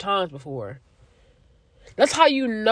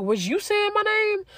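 very high, questioning disbelief; retold suspicion, pitch way up; incredulous asking; 12 semitones higher than usual. Looking at the lady in line, high incredulous question.

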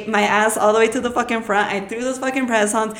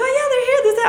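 much higher, bubbly receptionist; perky clerk answering, pitched way up; much faster than usual. Retold or imitated. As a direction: play the cheerful front desk girl. Receptionist voiced high.

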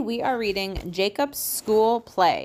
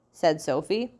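ordinary delivery

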